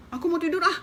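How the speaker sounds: high, loud huff; grumpy pig. Acted. Pig shooing the mouse so it can sleep, loud huff.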